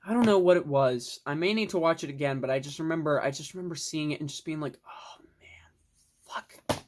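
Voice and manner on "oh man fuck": barely audible whispered dismay; hushed, voiceless retold reaction. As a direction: whisper it under your breath, as if reliving the moment. Retelling own reaction on seeing it.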